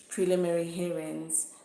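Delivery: usual speaking voice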